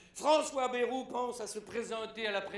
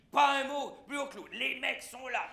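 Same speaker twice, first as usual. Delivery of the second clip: ordinary talk, nothing marked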